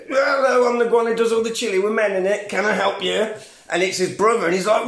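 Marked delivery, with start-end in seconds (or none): 0.1-3.3 higher, louder phone greeting patter; cheery chili seller. Retold or imitated. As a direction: answer the phone like a showy cook.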